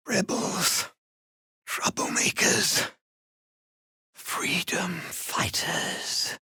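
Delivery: slow, breathy whisper of names; slow secret whisper. Acted. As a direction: whisper each word slowly. Rebel voice lists names.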